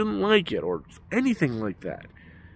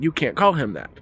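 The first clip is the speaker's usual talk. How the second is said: indignant; 7 semitones higher, 5 dB louder than usual. Retold or imitated. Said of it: "she's like".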